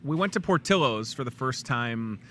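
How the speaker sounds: ordinary talk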